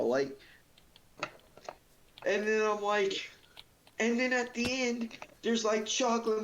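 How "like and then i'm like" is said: ordinary talk, nothing marked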